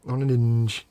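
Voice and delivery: "On an hinge" mimicked as "an inge", h dropped; imitating Cole. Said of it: "Just, just say hinge again".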